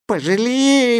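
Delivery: put-on high, pleading little boy's voice; high-pitched cry for pity; imploring child. A narrator's reading. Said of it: "взмолился маленький Чукча".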